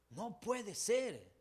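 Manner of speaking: high, loud and very slow wail of disbelief; No puede ser as a drawn-out anguished wail. Retold or imitated. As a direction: drag it out like you can't believe it. Voicing despair at relapse.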